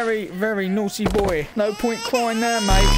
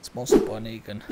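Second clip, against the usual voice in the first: much lower, softer announcer voice; deep announcer; 13 semitones lower, 6 dB quieter than usual. Acted. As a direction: sign off like a low trailer voice. Closing announcer tag.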